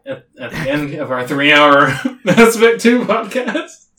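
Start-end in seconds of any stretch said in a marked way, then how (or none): 1.3-3.9 quick, sheepish words through laughter; laughing apology. Real, not acted. Apologizing for downer ending, laughing.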